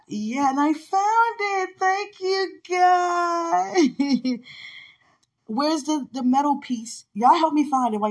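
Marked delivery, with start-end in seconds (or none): 0.1-4.4 high, drawn-out, half-sung cry of relief; joyful high-pitched praise; jubilant thanks. Real, not acted. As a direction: sing out thanks to God, stretching the last word. Celebrates finding the piercing.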